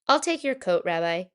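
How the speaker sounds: Bubba Braina. Acted. Old baker takes coat.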